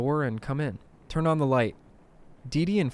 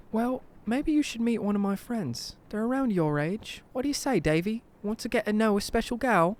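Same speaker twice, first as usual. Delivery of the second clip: put-on high-pitched English old-lady voice; sweet English granny, pitched high; 10 semitones higher than usual. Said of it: "She's originally from England".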